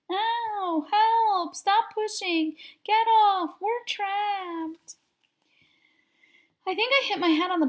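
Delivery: high, frantic cries; panicked kids' voices. A narrator's reading. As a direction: panicky yelps in the dark. Chaos in the dark pile.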